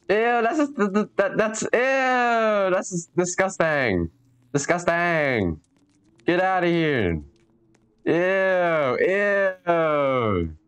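high, slow, drawn-out disgust. Real, not acted. Grossed out by the spider.

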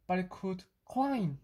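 ordinary delivery, nothing marked

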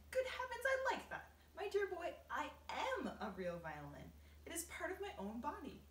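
high, loud exclaiming grasshopper; excited cry. A narrator's reading. Old grasshopper correcting the boy, high loud proud exclaiming. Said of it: "the old green grasshopper cried".

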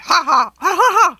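loud, high hysterical ha-ha; tickled goblin laughter. A narrator's reading. Goblin helpless while being tickled.

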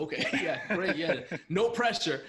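laughing through the words; nervous laugh while talking; chuckling at the pressure. Real, not acted.

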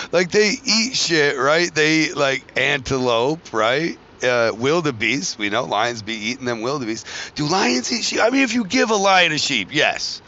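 ordinary talk

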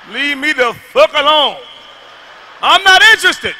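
loud, high brush-off, half-hearted outrage; mock outraged refusal. Comic tells his woman off, mock outraged. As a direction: shoo her away like a fed-up man.

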